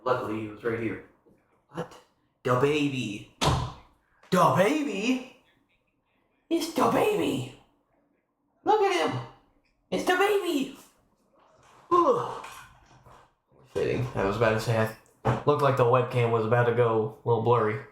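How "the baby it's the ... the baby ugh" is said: very high cooing voice. Real, not acted. Cooing at the kitten.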